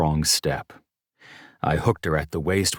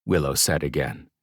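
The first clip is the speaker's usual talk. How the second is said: ordinary delivery, nothing marked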